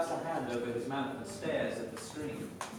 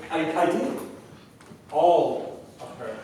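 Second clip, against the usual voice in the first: slow reply; 7 dB louder, slower than usual. Acted. Victor answers Craig slowly.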